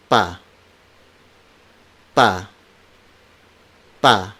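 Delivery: "Pa" with no puff of air after P; unaspirated P. Shown as a demonstration. Teacher shows learners the stop, "Pa" without aspiration. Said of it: "these consonants are unaspirated".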